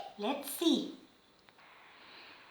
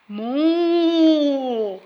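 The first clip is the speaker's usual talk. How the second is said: cow moo; 2 semitones higher than usual. Acted. Animal sound for kids.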